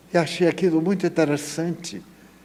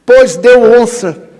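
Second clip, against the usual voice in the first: much higher and louder, bursting with joy; excited outburst; 8 semitones higher, 11 dB louder than usual. Real, not acted. Preacher telling the crowd his dream number won, jubilant cry. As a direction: shout the good news triumphantly.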